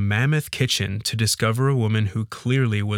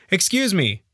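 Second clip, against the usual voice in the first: mild shout; 9 semitones higher than usual. A narrator's reading. Man calling to the maid over the vacuum, mild shout. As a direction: call out for attention without full volume. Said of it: "I shouted".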